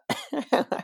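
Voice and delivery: shrill high bird squawk; peacock squawk. Shown as a demonstration. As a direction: screech like a peacock. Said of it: "How's that as a peacock impression?".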